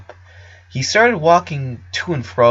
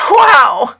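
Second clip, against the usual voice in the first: high, loud gasp of a word; astonished exclamation; overwhelmed boy; stunned wow; 10 dB louder than usual. A narrator's reading. Clyde reacts to shocking news.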